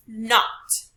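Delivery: NOT said loud and firm; stress on NOT. Shown as a demonstration. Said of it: "So we do raise our pitch".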